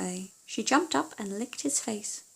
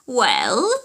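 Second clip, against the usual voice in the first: witch demanding; 9 dB louder than usual. Acted.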